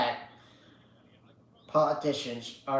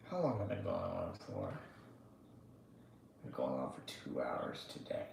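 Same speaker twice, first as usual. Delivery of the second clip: whispered aside; 14 semitones lower, 17 dB quieter, faster than usual. Real, not acted. Streamer whispering how long he has streamed. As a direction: whisper it to yourself.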